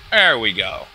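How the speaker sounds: normal talking voice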